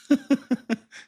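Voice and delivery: sudden high laugh; high cackling laugh; high burst of laughter. Real, not acted. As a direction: laugh out loud at the absurdity.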